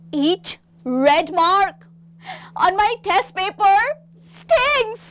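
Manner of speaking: slow, rising to a high loud sting; disgusted. Shown as a demonstration. Third student pointing at the red-marked paper, disgusted. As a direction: recoil from the paper as you say it. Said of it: "मुझे घिन आ रही है इससे".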